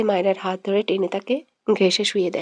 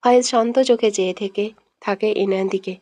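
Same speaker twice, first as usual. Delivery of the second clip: plain reading voice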